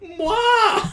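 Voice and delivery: loud, high "mwah" smooch; very high, showy kiss sound; exaggerated kiss noise. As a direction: plant a huge noisy kiss. Big smacking kiss on the cheek.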